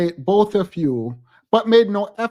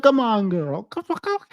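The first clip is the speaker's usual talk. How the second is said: mock urging; 4 semitones higher than usual. Urging Taylor in her head.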